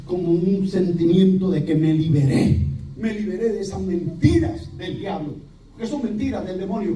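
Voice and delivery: usual speaking voice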